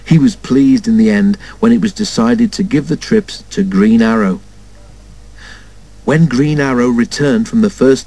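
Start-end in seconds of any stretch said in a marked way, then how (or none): none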